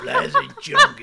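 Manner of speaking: laughing mock emcee intro; mock announcer. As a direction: bellow it like a strip club MC cracking up. Mock strip club announcer.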